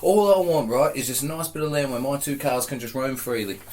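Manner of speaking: high, pleading mock English accent; impersonating English co-host. Guest plays co-host wanting land for cars, mock English accent. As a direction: pitch it up into a hammed-up English impression. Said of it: "that's my impersonation of you".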